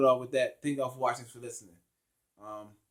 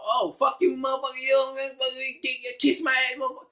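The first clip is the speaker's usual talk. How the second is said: mock cursing rant; 11 semitones higher than usual. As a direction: ham up a foul-mouthed churchgoer's rant. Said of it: "they talking like this".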